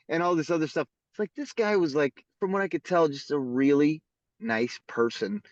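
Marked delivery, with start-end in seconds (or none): none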